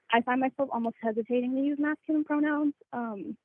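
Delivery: nervous, trembling voice; shaky voice. Real, not acted. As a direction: voice shaking with nerves. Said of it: "Sorry, my voice is shaking".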